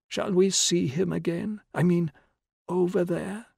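high, wondering frail voice; old woman whispering. A narrator's reading. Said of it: "and in another tone".

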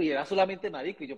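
usual speaking voice

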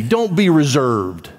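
ordinary talk, nothing marked

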